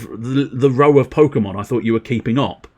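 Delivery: normal talking voice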